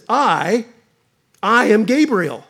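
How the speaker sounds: a touch of indignant angel; proud, firm, a hint of indignation; near indignation. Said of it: "Almost an indignation".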